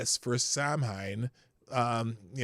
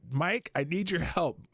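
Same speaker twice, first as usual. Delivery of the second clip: ordinary delivery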